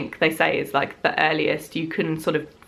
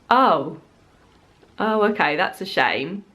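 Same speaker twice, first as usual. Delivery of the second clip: disappointed. Retold or imitated. Disappointed phone response, acted out.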